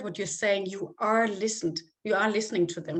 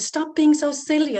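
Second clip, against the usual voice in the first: scolding parent; 6 semitones higher, 7 dB louder than usual. Retold or imitated. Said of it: "start saying to stop the emotions".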